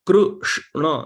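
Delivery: Krishna split into slow, separate sounds, Kru-sh-na; broken into syllables. Shown as a demonstration. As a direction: draw out Krishna piece by piece, isolating the middle sh. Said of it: "That half syllable is not counted".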